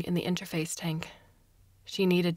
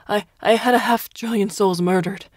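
louder, higher, cracking with tears; sobbing confession; 4 semitones higher, 8 dB louder than usual. A narrator's reading. Prisoner finally confessing to his torturer, sobbing. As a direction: wail it out at last.

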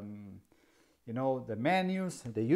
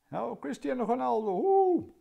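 high, loud joking cheer for Ronaldo; mock fan whoop, climbing high and loud; football fan cheer; 11 semitones higher, 5 dB louder than usual. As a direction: cheer him like a stadium crowd. Jokes about being Portuguese.

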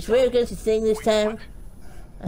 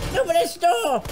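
raised, higher shout; 5 semitones higher than usual. Real, not acted. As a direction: demand the door open. Shouting at locked door.

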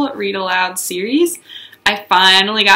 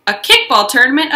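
kickball rising, a little louder; excited; 5 semitones higher, 5 dB louder than usual. Acted. Junie announcing her kickball news, excited. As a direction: thrill over "kickball" tournament.

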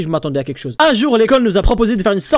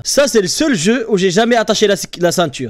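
ordinary talk

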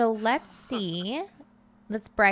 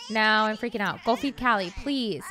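ordinary talk

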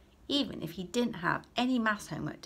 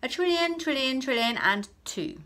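high, loud smug outbid; one-up the teacher gleefully; smug answer; 6 semitones higher, 7 dB louder than usual. Acted. Clever boy outbidding again, loud and smug. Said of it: "replied Brian".

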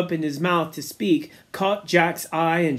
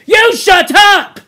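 slow, high, loud furious shout; fierce; 14 semitones higher, 12 dB louder, slower than usual. Acted. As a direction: roar him into silence. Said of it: "Jack turned fiercely".

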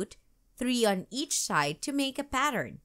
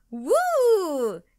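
high, loud woo; excited whoop; 10 semitones higher, 8 dB louder than usual. A narrator's reading. Katie getting on the horse, excited whoop. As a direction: whoop with joy.

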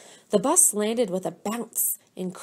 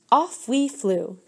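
ordinary delivery

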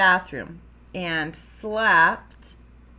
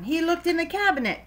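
ordinary delivery, nothing marked

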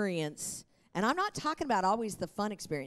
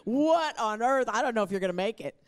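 panicking paramedic; 3 semitones higher, 4 dB louder than usual. Pretend. Pastor imitating a flustered paramedic for the congregation, fretting. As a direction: play a medic freaking out. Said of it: "the paramedic that goes".

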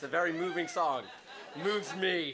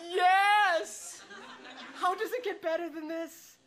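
loud, high, laughing yes; retold cheer at the holiday fun; joyful yes; 11 semitones higher, 4 dB louder, slower than usual. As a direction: burst out in delighted laughter. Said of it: "And I'm like".

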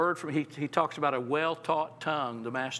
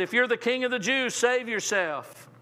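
scoffing taunt; 6 semitones higher than usual. Retold or imitated. Pastor retelling soldiers taunting Jesus, higher scoffing sneer. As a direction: sneer the challenge up at him. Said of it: "The soldiers scoffed".

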